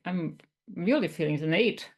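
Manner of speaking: usual speaking voice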